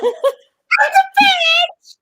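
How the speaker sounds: very high squealed shout; comic high-pitched yell. Shown as a demonstration. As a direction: shriek it up at the top of your voice. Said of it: "practice your voice pitch".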